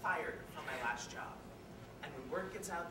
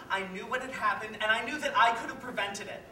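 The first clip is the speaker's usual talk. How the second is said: louder, rising self-reproach; guilty outburst; 2 semitones higher, 8 dB louder than usual. Acted. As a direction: let the guilt push the voice up.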